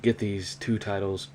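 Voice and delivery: ordinary talk, nothing marked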